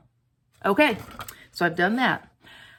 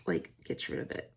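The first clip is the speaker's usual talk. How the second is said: whispered aside; 3 semitones lower, 11 dB quieter than usual. Real, not acted. Aside while using lifter.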